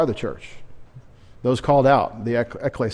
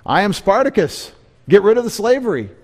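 higher, louder, faster rallying shout; hammed-up Spartacus-style battle cry; mock rebel cry; 7 semitones higher, 4 dB louder than usual. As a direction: ham up a defiant slave revolt call.

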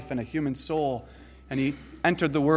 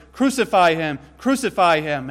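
loud, higher mob cry; crowd shouting; 3 semitones higher, 6 dB louder than usual. Retold or imitated. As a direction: call it out like a baying crowd. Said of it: "Pilate shouted".

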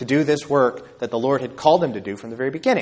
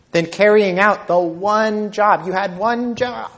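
slow mock rebuke; 7 semitones higher, 3 dB louder than usual. Preacher mocking rebuke.

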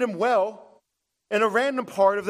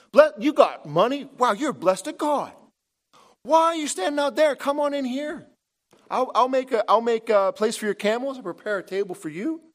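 Laban the prosperity preacher; 6 semitones higher than usual. Retold or imitated. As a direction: gush like a salesman spotting a rich client. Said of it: "Laban loves the prosperity gospel".

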